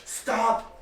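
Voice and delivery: loud high barked halt; guard's command. Acted. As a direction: shout it like a guard. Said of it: "He's like".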